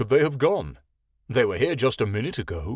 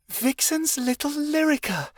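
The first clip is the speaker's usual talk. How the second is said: ordinary delivery, nothing marked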